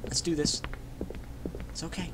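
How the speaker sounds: breathy whispered resolve. Real, not acted. Gamer sneaking past, whispering. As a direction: whisper to steady yourself.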